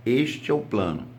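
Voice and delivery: normal talking voice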